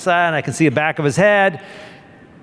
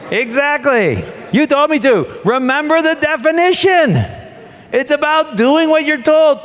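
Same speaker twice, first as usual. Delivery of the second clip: high, mocking know-it-all retort; 11 semitones higher than usual. Officer mocking the captain's order in a high squeaky voice. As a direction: squeak it back like a smug subordinate. Bill's reply retold, mocking.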